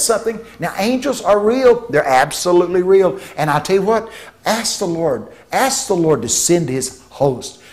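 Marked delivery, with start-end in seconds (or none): none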